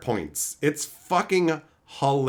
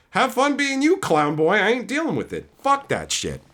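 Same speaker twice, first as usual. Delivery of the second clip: ordinary delivery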